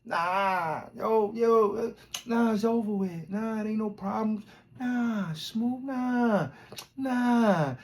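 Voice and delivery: high, lightly voiced smooth denial; smooth talker. Mocking the liar brushing off the beef, smooth. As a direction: brush it off like nothing happened. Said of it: "he was just smooth as shit".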